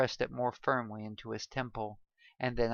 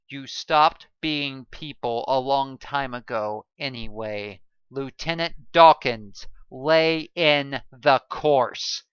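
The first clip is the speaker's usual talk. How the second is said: louder, slow commanding; hard cold order; 2 semitones higher, 11 dB louder than usual. A narrator's reading. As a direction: lay down the order without mercy.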